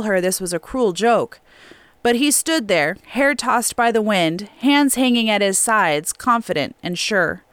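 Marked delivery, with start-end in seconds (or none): none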